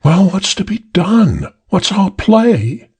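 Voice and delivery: high anxious woman; feminine voice. A narrator's reading. Woman in next room asking the plan, high anxious. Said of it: "The feminine voice from the next room".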